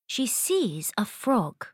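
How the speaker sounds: ordinary delivery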